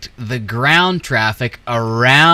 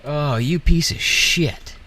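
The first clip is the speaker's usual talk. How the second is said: angry curse; 6 semitones higher, slower than usual. Real, not acted. Cursing at the game traffic.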